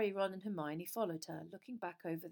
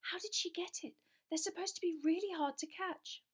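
put-on excited girl; 11 semitones higher than usual. A narrator's reading.

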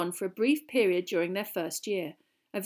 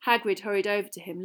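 usual speaking voice

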